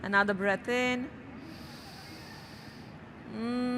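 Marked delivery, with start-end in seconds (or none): 3.4-3.8 soft steady hum through closed lips; a quiet closed-mouth buzz; humming bee sound. Shown as a demonstration. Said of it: "we'll make the sound of a humming bee".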